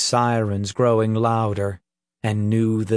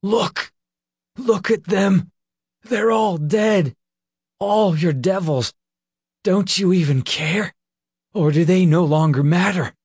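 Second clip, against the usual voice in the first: furious yelling; 8 semitones higher than usual. A narrator's reading. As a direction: scream it at him in outrage. Nick screams at Peter.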